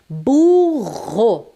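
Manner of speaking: "Burro" alongado, com RR forte e aspirado. Shown as a demonstration. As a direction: diga "Burro" com força no RR. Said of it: "a pronúncia exige força". (erre forte em "Burro")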